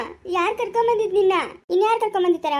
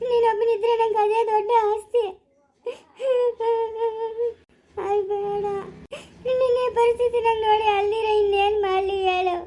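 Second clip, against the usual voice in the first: crying while pleading, pitched higher; tearful pleading; 5 semitones higher than usual. Acted. Mother begging her injured son, crying as she pleads. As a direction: let the voice break into tears.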